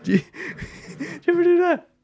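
high-pitched giggly question through laughter; can't stop laughing while asking. Real, not acted.

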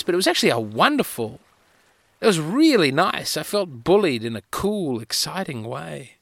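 ordinary delivery

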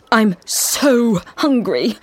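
slow drawn-out whiny moan; moaning hungry. A narrator's reading. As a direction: whine it out, starving. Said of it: "moaned Carl".

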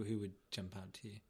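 ordinary talk, nothing marked

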